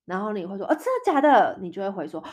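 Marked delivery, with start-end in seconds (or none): 0.7-1.6 higher, louder, amazed disbelief; surprised. Shown as a demonstration. Hearing the friend dates the neighbour, surprised and louder. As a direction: gasp it like shocking news. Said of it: "让你很惊讶".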